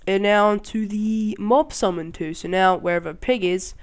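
usual speaking voice

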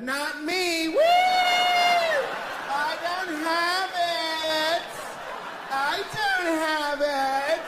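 high loud drawn-out cheer; mock celebration. Patient in a cancer ward cheering, hammed-up. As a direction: celebrate wildly like escaping a diagnosis. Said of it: "like going to a cancer ward".

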